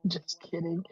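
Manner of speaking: whispered to himself. Real, not acted. Streamer whispering to himself while cleaning the humidifier. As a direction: breathe it out as he scrubs.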